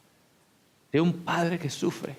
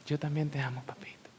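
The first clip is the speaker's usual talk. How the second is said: hushed, fading to a whisper; soft child reply; 9 dB quieter than usual. Retold or imitated. Small child speaking to God. (susurro de niño pequeño)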